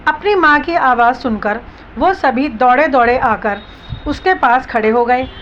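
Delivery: plain reading voice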